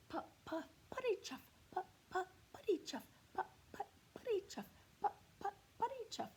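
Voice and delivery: high, rhythmic puff-puff chant; tractor chugging. Acted. Teacher chants Otis the tractor's engine noise for the children, high and rhythmic.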